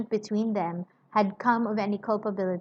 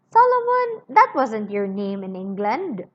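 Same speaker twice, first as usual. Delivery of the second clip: sudden shout; 7 dB louder than usual. Acted. Cruncher calling out Solomon's false name, loud shout. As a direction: blurt the name out at full volume.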